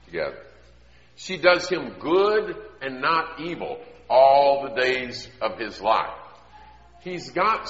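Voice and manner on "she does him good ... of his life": slow, sing-song scripture reading. Acted. Preacher intoning Proverbs to the wives, sing-song. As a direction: lilt the verse slowly.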